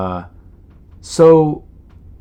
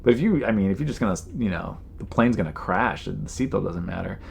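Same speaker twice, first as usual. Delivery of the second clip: ordinary delivery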